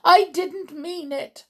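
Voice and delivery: high, loud protest; miserable cry. A narrator's reading. Culprit protests to her leader, high miserable cry. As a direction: plead it in fright. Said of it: "cried the miserable culprit".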